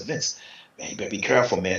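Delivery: a friend's secret heads-up; hushed warning. Retold or imitated.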